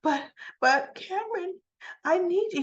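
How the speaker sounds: high, mock-desperate plea; whiny imitation of begging; retold pleading. Recapper voicing Joss begging Cameron, pleading.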